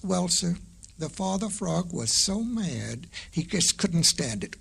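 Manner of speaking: plain reading voice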